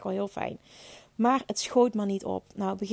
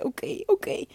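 meek acceptance; 9 semitones higher than usual. Retold or imitated. Re-enacts her reply in labour.

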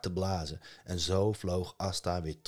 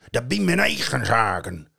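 loud troll rebuff; 5 semitones higher, 9 dB louder than usual. Acted. Troll telling Loki off, loud. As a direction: brush him off like a troll.

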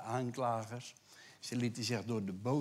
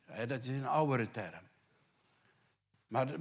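normal talking voice

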